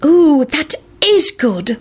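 high, drawn-out delight; happy. A narrator's reading. Luna delighted by the discovery, happy. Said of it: "Luna said happily".

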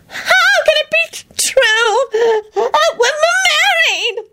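very high sobbing screech. A narrator's reading. Loretta to Quentin, very high sobbing screech. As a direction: screech it in despair. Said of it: "Loretta screeched".

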